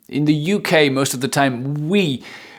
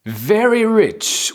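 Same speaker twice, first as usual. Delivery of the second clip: high announcement; 8 semitones higher, slower than usual. Shown as a demonstration. Announcing new word.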